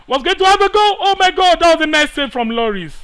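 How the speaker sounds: high, loud yell at the save; excited shout. Real, not acted. Gamer yelling at the keeper's save, excited. Shot saved in football game.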